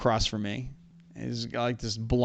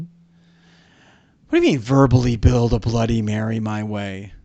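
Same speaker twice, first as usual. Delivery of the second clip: incredulous; 6 dB louder than usual. Real, not acted. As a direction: balk loudly at an absurd request. Balking at an odd challenge.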